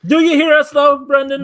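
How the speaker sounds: loud, high-pitched call to a co-host. Real, not acted. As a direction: call out to check they can hear you. Checking if remote co-host hears.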